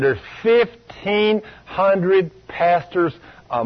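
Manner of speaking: FIFTEEN HUNDRED PASTORS slow, in separate beats; emphatic figure. Real, not acted. Preacher repeating the count of fallen pastors, "fifteen hundred" slow and emphatic.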